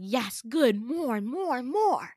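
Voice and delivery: hammed-up, building encouragement; mock eager teacher. Retold teacher egging on the actors.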